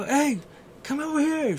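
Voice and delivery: calling his daughter over the music. Retold or imitated. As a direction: shout to her across the rink.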